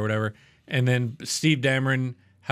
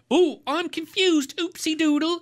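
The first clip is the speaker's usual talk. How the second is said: high, slow, hammed-up Cockney bumbler; mock the dithering voice, pitched way up; mocking bumbling character. Mocking the actor's confused alter ego, mock Cockney. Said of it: "How many times is he gonna stroke out".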